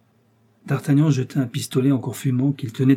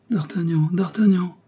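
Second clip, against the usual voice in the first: high, weakened calling; distressed cry; 7 semitones higher than usual. A narrator's reading. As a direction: call your lover as you are dying. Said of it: "s'écria madame Bonacieux". (appel de détresse, aigu)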